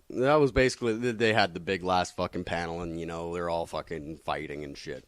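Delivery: ordinary delivery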